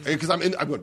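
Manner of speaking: ordinary talk, nothing marked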